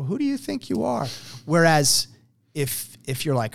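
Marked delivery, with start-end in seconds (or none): 0.0-1.1 high, indignant challenge; mock indignant. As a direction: mimic someone taking offence, pitched way up. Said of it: "somebody's like".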